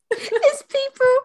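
high, squeaky words through laughter; helpless laughter. Real, not acted. Telling friends about a TV show, helplessly laughing. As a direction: barely get it out for laughing.

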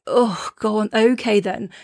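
usual speaking voice

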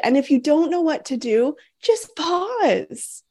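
calm her like a whisper in the ear; reassuring. Pretend. Host imagines coaching a couple through an earpiece, reassuring. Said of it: "and I wanna say".

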